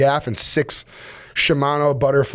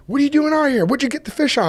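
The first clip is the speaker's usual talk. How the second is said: curious captain; 11 semitones higher, faster than usual. Retold or imitated. Captain asks, voiced.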